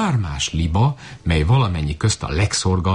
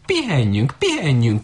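goose flock cry; 8 semitones higher than usual. A narrator's reading. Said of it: "kiáltotta a sereg".

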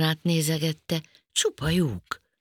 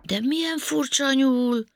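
amazed; 11 semitones higher, 3 dB louder than usual. Acted. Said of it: "ámuldozott Panka".

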